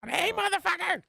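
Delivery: mock shock jock yell.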